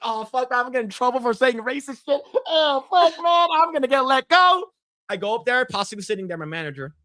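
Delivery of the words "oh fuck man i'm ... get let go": high, whiny playacted dread; acted panic. Employee dreading the manager's office and being let go, mock panic. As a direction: wail it high, as if doomed. Acting out fear of being fired.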